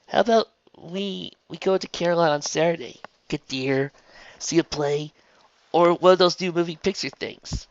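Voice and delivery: high, tentative character voice; shy, hopeful Raindrops. A narrator's reading. Raindrops invites Trixie out on Saturday, hopeful. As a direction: start haltingly, then press on with the offer.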